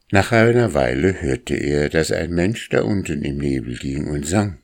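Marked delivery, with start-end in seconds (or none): none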